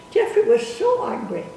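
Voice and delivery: normal talking voice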